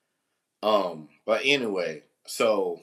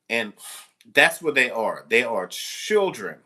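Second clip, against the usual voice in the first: ordinary talk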